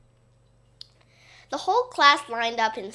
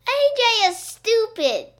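high, loud taunting holler; yelling; 11 semitones higher, 6 dB louder than usual. A narrator's reading. Boy taunting AJ in the gym, yelling. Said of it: "yelled Ryan".

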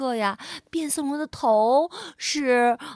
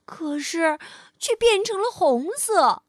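ordinary delivery

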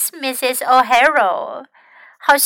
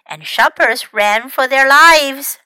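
usual speaking voice

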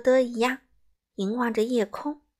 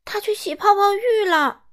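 higher and louder called answer; raised voice over the commotion; 9 semitones higher, 6 dB louder than usual. Acted. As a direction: call it across the house.

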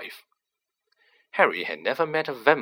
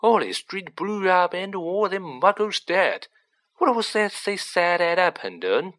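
lightly voiced gossipy conductor, pitched up; higher, lightly played character voice; chatty bus conductor; 6 semitones higher than usual. A narrator's reading. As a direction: ask a mate mid-gossip. Voices Stan in the story.

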